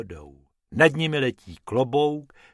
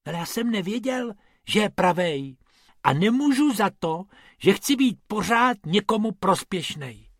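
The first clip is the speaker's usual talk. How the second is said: whimpering; 7 semitones higher than usual. A narrator's reading. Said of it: "fňukal Bobek".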